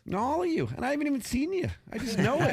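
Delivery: high gushing falsetto praise. Real, not acted. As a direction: gush in a very high voice.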